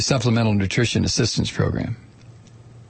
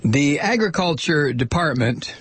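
ordinary talk, nothing marked

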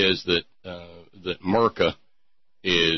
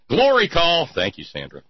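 usual speaking voice

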